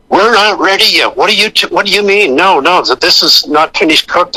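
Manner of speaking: mock screaming. Caterers refuse the AD calling lunch, mock screaming. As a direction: imitate a flustered caterer screeching back. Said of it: "they're screaming and going".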